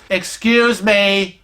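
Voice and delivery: slow, drawn-out request for attention. Retold or imitated. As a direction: stretch it out to be heard. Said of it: "a little louder".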